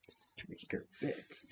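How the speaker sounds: whispered through a soft laugh, slow. Real, not acted. Still amused by the viewer's comment, laughing whisper. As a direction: giggle and whisper the phrase.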